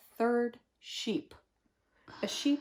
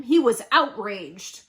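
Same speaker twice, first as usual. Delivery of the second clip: outraged; 8 dB louder than usual. A narrator's reading. Narrating the wolf's anger.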